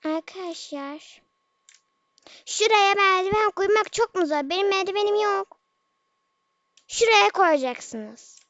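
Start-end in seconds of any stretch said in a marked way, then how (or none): 2.5-8.4 loud, frustrated yelling at teammates; exasperated gamer yelling over the mic; real shouting. Real, not acted. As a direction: shout at them to place the ladder. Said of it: "Bağırıyorum".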